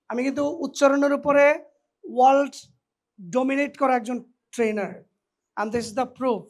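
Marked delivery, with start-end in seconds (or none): none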